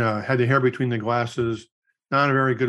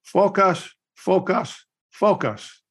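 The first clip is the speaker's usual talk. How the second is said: put-on raised, punchy Korean-accented delivery; imitated Korean accent; 7 semitones higher, much slower than usual. Korean friend reveals his secret to dating, imitated Korean accent. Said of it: "in his Korean accent".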